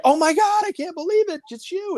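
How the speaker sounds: very high, gushing retold surprise; excited. Greeting the woman whose name was forgotten, excited squeal. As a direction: squeal it in mock-breathless delight at spotting someone. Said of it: "why I was so excited to see her".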